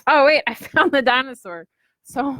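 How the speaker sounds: laughing as she finds the dinosaur; light real laugh mid-sentence. Real, not acted. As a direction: giggle through the discovery. Laughs finding dinosaur charm.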